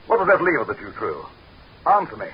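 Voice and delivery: high, sharp command; angry demand. Acted. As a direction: snap the order. Leader demanding an answer.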